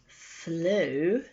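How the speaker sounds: ordinary delivery, nothing marked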